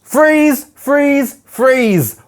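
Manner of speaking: loud, high repeated calls, easing down; calling out. Real, not acted. Teacher halting the angry readers with loud calls. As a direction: call out to stop everyone.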